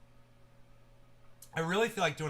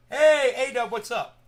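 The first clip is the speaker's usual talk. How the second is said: calling out; 7 semitones higher, 11 dB louder, slower than usual. Real, not acted. Greeting a friend arriving.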